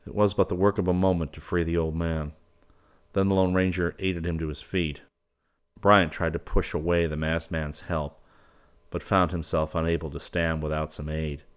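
ordinary delivery, nothing marked

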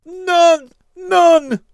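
high, loud horrified scream. Acted. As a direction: shriek it in horror. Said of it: "screamed Barreau".

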